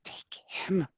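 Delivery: urgent whisper; hushed whispered order; whispered insist. Acted. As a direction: hiss it under the breath.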